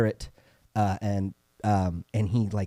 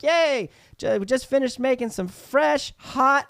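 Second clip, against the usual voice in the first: much higher, chirpy waitress voice; mock cheery; slower than usual. Barista greeting customers with fake cheer. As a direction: sell the coffee with a bright chirp. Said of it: "I'm like".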